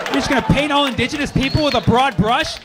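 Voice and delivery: hammed-up angry lecture; mock rant. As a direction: tear into her in mock fury. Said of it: "I was like".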